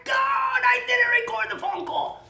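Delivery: hammed-up high cry of regret; mock anguish. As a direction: wail it like a hammed-up disaster. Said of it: "and I go like".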